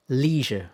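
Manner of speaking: leisure with a long "ee", as "LEE-zher"; American pronunciation. Shown as a demonstration. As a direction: stretch the first vowel of leisure to "ee".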